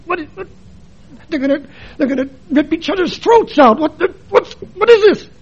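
high, alarmed what-what-what; high startled confusion; bewildered. Retold or imitated. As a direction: sputter in shocked disbelief. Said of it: "And I'm going".

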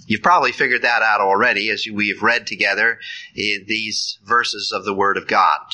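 usual speaking voice

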